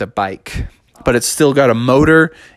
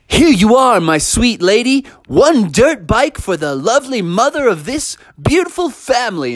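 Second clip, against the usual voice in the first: high, swooping flattery; gallant employee; 9 semitones higher than usual. Acted. Employee presenting Mother Bear her bike, overdone gallant charm. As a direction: lay on the charm, hammed-up. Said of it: "walked up to Mother Bear and said".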